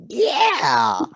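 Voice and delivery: loud, high burst of agreement; excited. Real, not acted. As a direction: shout it in eager agreement. Co-host agreeing enthusiastically.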